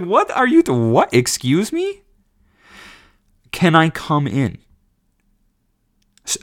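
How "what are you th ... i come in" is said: incredulous shock. Retold or imitated. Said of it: "I was like".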